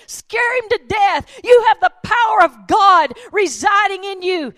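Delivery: loud, high preacher's shout; rising call to run at the storm; full-force preaching. Acted. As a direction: urge the congregation on at full volume.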